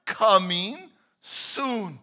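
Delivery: ringing announcement; loud proclaiming. Pretend. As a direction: proclaim it to the room. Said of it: "He says".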